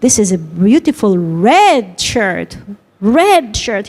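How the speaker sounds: slow line with red stressed and falling; slow colour teaching with red clearly stressed. Shown as a demonstration. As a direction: hit red in red shirt, slowly, then say it again. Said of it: "You have to highlight that word".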